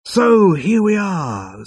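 slow, high, faltering start; hesitant. Acted. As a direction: edge into it unsure. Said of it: "said Zaphod hesitantly".

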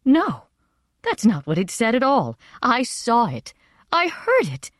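high, insistent denial, rising sharply; upset protest. A narrator's reading. Mother refusing her husband's account of the oracle, protesting in distress.

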